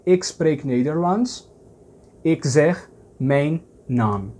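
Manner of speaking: slow, word by word; slow Dutch example. Shown as a demonstration. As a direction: spell out the Dutch phrase for a learner. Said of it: "Em holandês".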